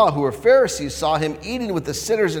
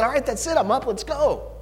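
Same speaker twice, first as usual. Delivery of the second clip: eager Levi; 11 semitones higher than usual. Retold or imitated. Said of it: "he said".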